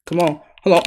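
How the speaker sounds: ordinary talk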